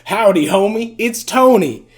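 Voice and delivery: hammed-up imitation of a country accent; imitating Tony's country accent. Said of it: "a country accent".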